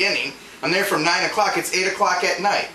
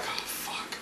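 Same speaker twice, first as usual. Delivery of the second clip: whispered curse under the breath; muttered dismay; 16 dB quieter than usual. Retold or imitated. Tired clerk curses privately at late order, whispered dismay. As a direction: breathe it out, barely audible.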